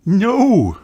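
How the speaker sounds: high, bright no; mother correcting her child; mother's reply. A narrator's reading. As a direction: answer like a mother. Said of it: "replied Mummy Pig".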